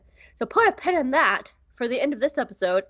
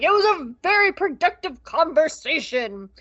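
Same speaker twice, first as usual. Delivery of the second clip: Yularen voice; 3 semitones higher, 4 dB louder than usual. Retold or imitated. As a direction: report back like a pompous Imperial. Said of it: "I got lightheaded doing that voice".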